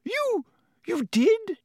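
very high, faltering disbelief; stunned. A narrator's reading. Professor McGonagall hearing the knight's confession, stunned. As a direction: squeak it out, aghast. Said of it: "There was a stunned silence".

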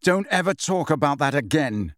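plain reading voice

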